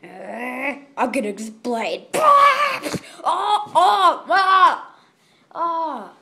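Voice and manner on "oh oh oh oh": loud high startled cries; alarmed oh oh. Real, not acted. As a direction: yelp as something goes wrong. Sudden alarm in game.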